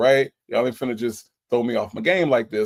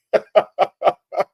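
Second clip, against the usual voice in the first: loud, high burst of laughter; real belly laugh; 9 semitones higher, 6 dB louder than usual. Real, not acted. Amused by viewer's rehab comment.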